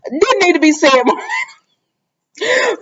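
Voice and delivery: high, stretched-out shout through laughter; squealed, laughing telling-off; mock scolding. Real, not acted. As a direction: call her out in exasperated fun.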